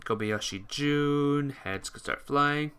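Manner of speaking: usual speaking voice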